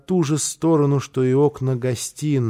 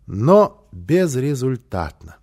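usual speaking voice

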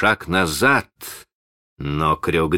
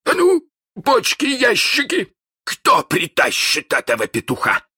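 high, loud, booming captain; thundering; 4 dB louder than usual. Acted. Hook roars at his crew, high and loud. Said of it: "загремел он".

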